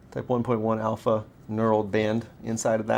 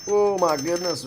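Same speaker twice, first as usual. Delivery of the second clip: startled exclamation; 7 semitones higher, 6 dB louder than usual. Real, not acted. Reacting to loud noise.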